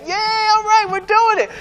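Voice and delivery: mock crowd cheering at good news; excited cheer. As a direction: squeal it like thrilled churchgoers. Acting the eager church crowd.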